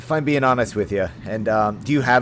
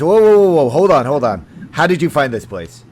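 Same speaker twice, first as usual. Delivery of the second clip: loud, high "whoa" that settles into a wary challenge; startled guard; 4 semitones higher, 7 dB louder than usual. Acted. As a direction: stop the stranger cold, then ask sharply. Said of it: "The one on the left says".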